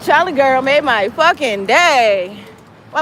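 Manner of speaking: ordinary talk, nothing marked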